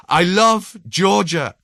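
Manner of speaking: high, slow shout out the window. A narrator's reading. Georgian man leans out the window, shouting high and slow. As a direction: shout it to the whole street, slowly. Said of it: "I shout".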